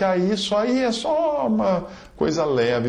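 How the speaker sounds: high, slow, airy brush-off; dismissive mock voice. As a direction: shrug it off like it's nothing. Imita leitor que minimiza racismo. (desdém zombeteiro)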